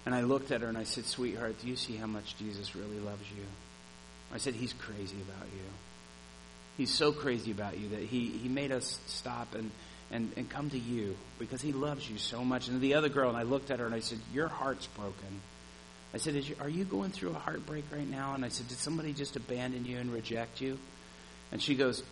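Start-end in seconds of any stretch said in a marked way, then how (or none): 1.1-12.7 put-on soft, low, tender reassurance to a weeping girl; tender comforting. To a crying girl he met, a soft tender voice. 14.3-20.8 gentle asking. Retold or imitated. To the heartbroken girl, soft gentle questioning.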